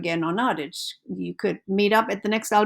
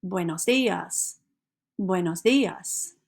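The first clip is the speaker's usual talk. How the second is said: Buenos dias said slowly and clearly for learners; slow model phrase; slower than usual. Shown as a demonstration. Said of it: "Go ahead and try it".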